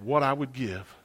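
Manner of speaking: soft, low and fond; wistful longing. Retold or imitated. Grown pastor missing the old sister, soft and wistful. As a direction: remember her tenderly, almost to yourself. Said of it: "And then he said".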